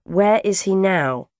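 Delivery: worried mother's question; anxious demand. A narrator's reading. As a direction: ask insistently, drawing it out. Anguished mother asks after son.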